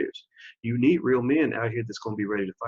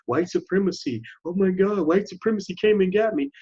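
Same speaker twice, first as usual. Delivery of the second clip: higher-pitched mock-victim wail; mock dismay; 7 semitones higher than usual. Host mimicking a man knocked down by life, mock dismay. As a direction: imitate someone helplessly shocked, pitched up.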